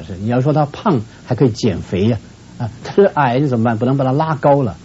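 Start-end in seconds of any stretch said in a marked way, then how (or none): none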